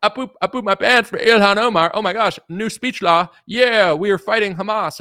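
high, loud whiny mock voice; hammed-up shrill parody of an outraged account; mocking hysterical poster. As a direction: send up a panicky online partisan.

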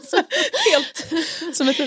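laughing through the words. Real, not acted. Laughing to the host at her own childishness. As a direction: giggle while admitting it.

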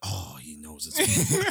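low, groaned "oh" of realisation; mock dread, dropped very low; sinking dread. Acting out dread of being caught.

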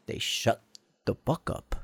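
whispered. Real, not acted. Crickets go quiet.

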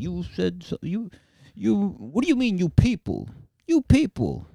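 a hint of an offended tough guy repeating "You people?"; Stephen Graham impression. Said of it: "make him sound like fucking Richard Roundtree".

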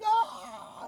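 very high mock wail of crying; a hammed-up sobbing howl. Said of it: "she's crying".

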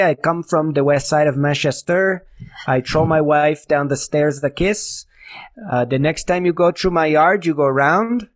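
mock French Canadian English; imitating French Canadian turns of speech; French Canadian accent. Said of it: "the extent of my French Canadian".

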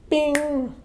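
high sung ping; email chime imitation. Author mimicking the email arriving, high chime. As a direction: chirp it like a computer chime.

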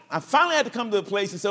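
ordinary talk, nothing marked

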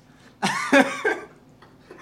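loud, high, breathy cackle of laughter; burst of laughter. Real, not acted. Laughing at being fooled by his buddy, a loud high cackle. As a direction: crack up at the punchline.

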